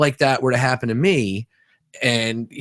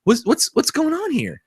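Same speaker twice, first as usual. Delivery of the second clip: high-pitched mock alarm, stammering; startled client; 11 semitones higher than usual. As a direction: act out a baffled guy caught off guard. Retold startled massage client.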